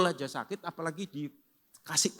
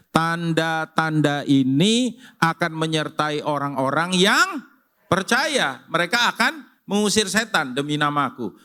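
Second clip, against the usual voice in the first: loud scripture reading; 2 semitones higher, 7 dB louder than usual. Acted. Pastor leads the congregation reading Mark aloud, proclaiming loudly. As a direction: declare the scripture with the whole church.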